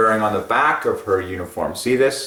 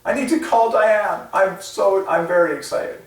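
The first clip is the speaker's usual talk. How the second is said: high and giddy, pitch soaring on Diane; high squealy excitement, quote acted out; gushing excitement; 10 semitones higher, 4 dB louder than usual. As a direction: gush it like a thrilled friend. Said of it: "she's like".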